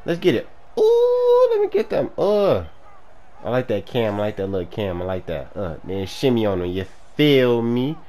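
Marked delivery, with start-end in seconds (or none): 0.8-1.9 very high, hooting voice; playful falsetto. Real, not acted. Gamer celebrating his touchdown, playful falsetto. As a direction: squeal it in celebration.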